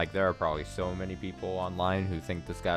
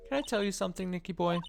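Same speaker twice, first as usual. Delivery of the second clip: voiced higher, coy Desiree; high-pitched woman voice; 10 semitones higher, faster than usual. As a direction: coax him in a high coy voice. Desiree character voice.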